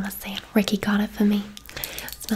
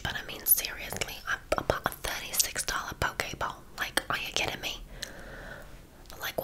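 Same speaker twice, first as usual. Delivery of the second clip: soft whispered aside about a coworker; confidential whisper; 8 dB quieter than usual. Acted. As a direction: whisper a gossipy complaint to the client.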